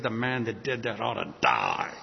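low, grim anger, acted; angry King David. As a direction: pronounce an angry death sentence, low and heavy. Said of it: "David was angry and says".

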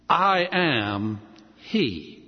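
very slow, weighty declaration; solemn Jesus. Acted. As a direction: let each word land with reverence. Jesus's answer, solemn reading.

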